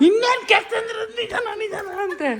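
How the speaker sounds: very high teasing echo; high, incredulous mimicking; mock disbelief. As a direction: throw the question back in a high-pitched voice. Son echoes mother's disbelieving question.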